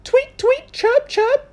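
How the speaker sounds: very high, chirpy bird imitation; bird chirps. Music teacher imitates birds.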